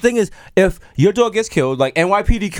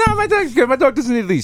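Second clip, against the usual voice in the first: mocking friend; 10 semitones higher than usual. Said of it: "Miro talk about".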